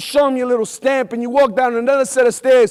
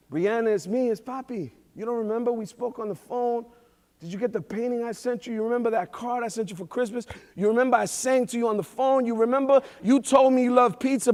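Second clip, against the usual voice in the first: much softer, hushed and gentle; a father softly reminding his small daughter who he is; soft father's voice; 5 dB quieter than usual. Retold or imitated. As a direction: coax a little girl, barely above a breath.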